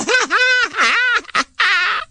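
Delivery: high, cackling crowd laugh; roaring laughter. A narrator's reading. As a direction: imitate animals roaring with laughter. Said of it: "they were roaring with laughter".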